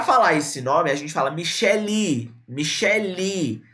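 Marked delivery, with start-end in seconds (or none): 1.3-2.3 "Michele" with the last i drawn out; final vowel extended. Shown as a demonstration. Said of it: "A gente estende a vogal". 2.5-3.6 "Michele" very slow, final i held long; final vowel extended. Shown as a demonstration. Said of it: "A gente estende a vogal".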